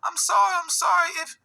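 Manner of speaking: high, loud crying plea; mock tearful begging; crying apology. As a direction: imitate her sobbing sorry in a high voice. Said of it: "She started crying".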